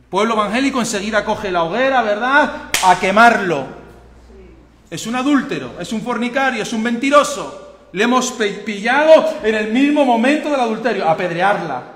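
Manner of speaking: loud, high pulpit cry; fiery preaching. Acted. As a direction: thunder the scripture at the church. Preaching at full force.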